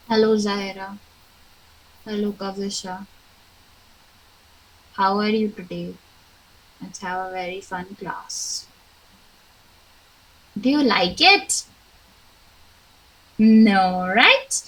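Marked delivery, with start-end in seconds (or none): 0.1-8.7 lower, soft, lifeless hello; dull greeting. Shown as a demonstration. Teacher showing pupils a joyless greeting, flat and low. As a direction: greet them with no life at all. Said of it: "I talked to you like this".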